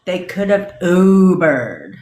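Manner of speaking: sarcastic repeat of the excuse; mock drawl. Shown as a demonstration. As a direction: stretch Ubered out in disbelief.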